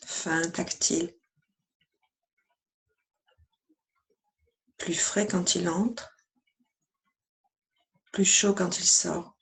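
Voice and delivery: even, measured meditation voice with long pauses; guided meditation. Acted. Yoga teacher guiding listeners lying down through yoga nidra, calm meditation voice.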